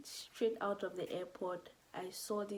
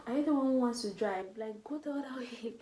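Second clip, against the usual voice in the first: higher, louder mock-startled question; retold startled question; 4 semitones higher, 8 dB louder than usual. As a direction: act out baffled disbelief, breaking into a laugh.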